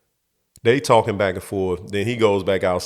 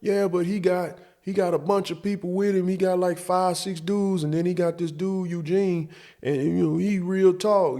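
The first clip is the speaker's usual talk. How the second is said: put-on high whiny kid voice; 9 semitones higher than usual. Young self pleading with dad.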